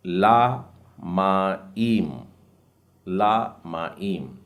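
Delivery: ला माईम said very slowly; ला माईम stretched out; slow model word. Shown as a demonstration. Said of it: "उसके बाद का शब्द है". (ला माईम बहुत धीरे)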